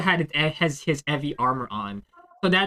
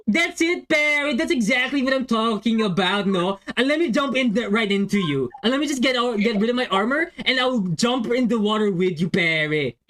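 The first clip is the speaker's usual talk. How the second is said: put-on higher, far-off Konyo accent as Pepe Yeager; 6 semitones higher than usual. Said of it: "but in the Konyo accent".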